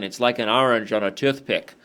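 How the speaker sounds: put-on hammy Scottish accent, movie quote; overdone Scottish accent, comic; bad Scottish accent. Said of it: "that was a really bad Scottish accent".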